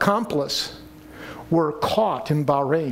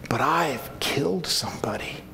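anguished confession; 5 semitones lower, 4 dB quieter than usual. Pretend. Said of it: "So you may be saying".

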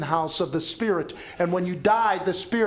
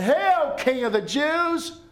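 higher, louder mock salute; mocking soldiers; 11 semitones higher, 5 dB louder, slower than usual. Soldiers bowing to mock Jesus, high jeering hail.

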